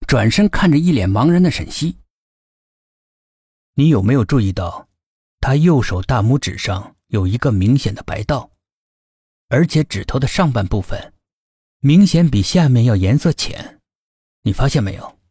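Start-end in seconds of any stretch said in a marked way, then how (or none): 3.7-15.2 lower, knowing man's voice; smug young man. A narrator's reading. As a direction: lay it out smugly. K explaining smugly.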